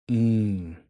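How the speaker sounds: ordinary talk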